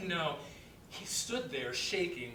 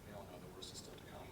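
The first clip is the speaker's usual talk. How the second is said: lower, soft, breathless near whisper; 8 semitones lower, 14 dB quieter, faster than usual. Real, not acted. [name] to Kat about his mother's illness, hushed and grim. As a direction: let the dread slip out under the breath.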